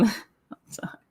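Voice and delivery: breathy whispered sign-off; a hushed, playful I'm done. Pretend. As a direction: whisper it like a secret. Whispered repeat of catchphrase.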